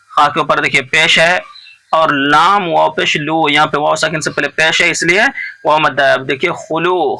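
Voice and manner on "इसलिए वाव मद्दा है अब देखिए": ordinary talk, nothing marked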